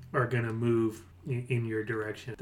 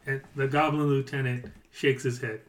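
ordinary talk

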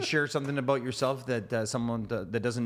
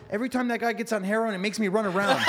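higher, deadpan lion explaining; higher matter-of-fact lion; lion's punchline; 10 semitones higher, 5 dB louder than usual. Acted. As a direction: deliver the punchline pitched up. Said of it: "He goes".

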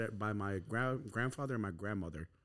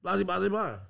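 raised, louder nagging voice; mocking family; 6 semitones higher, 6 dB louder than usual. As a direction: mimic relatives lecturing him. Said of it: "so they told me".

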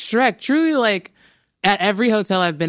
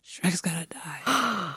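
whispered fake sorrow; 2 semitones higher, 8 dB quieter, much slower than usual. Tearful whispered verdict.

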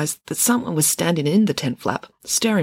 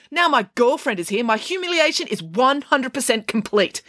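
high-pitched humiliated outburst; exasperated shouting; 9 semitones higher than usual. Acted. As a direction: rage at total humiliation. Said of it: "shouted Ian".

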